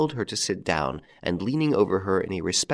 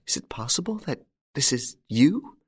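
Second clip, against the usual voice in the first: breathless whispered disbelief; astonished whisper; 6 semitones higher than usual. A narrator's reading. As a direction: hardly dare believe it. Stunned disbelief.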